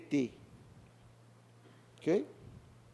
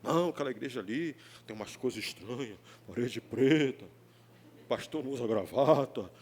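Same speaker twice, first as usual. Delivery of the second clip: much lower, odd put-on gossip voice; gossiping churchgoer; 9 semitones lower than usual. Pastor mimicking churchgoers gossiping about the church, lower gossip voice. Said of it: "De vez em quando a gente ouve isso".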